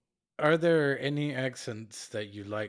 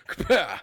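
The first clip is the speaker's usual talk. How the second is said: punch sound effect; 7 semitones higher, 8 dB louder than usual. Retold or imitated. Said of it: "he just walks up".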